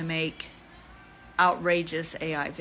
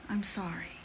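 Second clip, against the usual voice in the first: soft breathy whisper of sorry; whispered apology; 5 dB quieter than usual. Real, not acted. Apologizing to viewers in a hushed whisper. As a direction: breathe the apology out, barely voiced.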